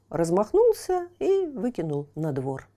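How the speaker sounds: ordinary delivery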